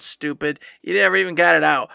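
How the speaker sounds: ordinary talk, nothing marked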